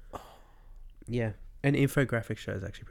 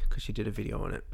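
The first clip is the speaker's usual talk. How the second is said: ordinary delivery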